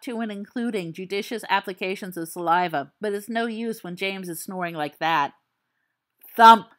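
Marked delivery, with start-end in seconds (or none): 6.3-6.7 a sudden loud thump. Acted. Storyteller makes the monster's loud thump. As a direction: bang it out to startle.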